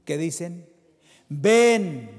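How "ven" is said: calling out. Retold or imitated. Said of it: "el Espíritu y la esposa dicen".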